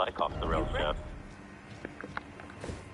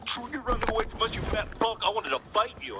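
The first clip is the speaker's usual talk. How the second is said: pitched-up sneering taunt, imitating the guy; mocking heckler voice; 10 semitones higher than usual. Podcast host imitating Mike's taunt at the fleeing man, mock sneering. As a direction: jeer like a smug heckler, voice raised high. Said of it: "That's what Mike's insinuating".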